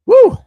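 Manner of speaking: very high, loud whoop; hype woo. Real, not acted. Host firing himself up mid-show, very high loud whoop. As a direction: let out a big whoop to pump yourself up.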